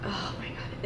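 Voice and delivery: breathy whisper of delight; whispered awe. Real, not acted. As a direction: whisper it, amazed by the smell. Smelling the new candle.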